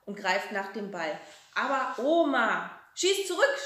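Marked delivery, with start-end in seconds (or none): 1.6-2.7 protesting. Acted. Boy protests after ball hit. 2.9-3.6 much higher, loud call; shouting. A narrator's reading. Grandma dares the boy to kick back, shouting. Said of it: "schreit die Oma".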